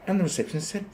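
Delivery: ordinary talk, nothing marked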